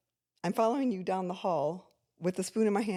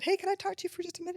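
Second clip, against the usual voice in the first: much higher and fast, sing-song; sweet mom voice; 12 semitones higher, faster than usual. Retold or imitated. Mother retelling how she sweetly opened the talk, high and fast. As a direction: ask gently, overly sweet. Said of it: "and I said".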